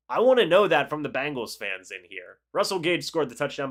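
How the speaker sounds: usual speaking voice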